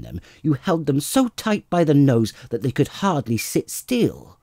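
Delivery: loud, high tirade of insults; angry scolding wife. Acted. Wife berating her husband over the nose, loud and high. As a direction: berate him, pitch climbing. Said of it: "her face red with anger".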